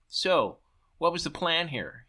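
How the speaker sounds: ordinary delivery, nothing marked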